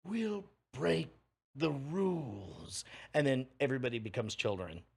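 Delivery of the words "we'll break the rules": high, grinning, slowing on the rules; big grin voice. Retold or imitated. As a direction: beam it like a giant grin. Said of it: "And he goes".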